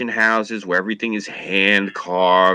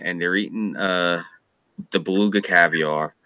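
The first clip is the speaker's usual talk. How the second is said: ordinary delivery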